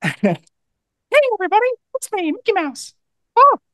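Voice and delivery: high falsetto cartoon mouse; squeaky cheerful falsetto greeting; Mickey Mouse voice. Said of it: "try my hands at a Mickey impersonation".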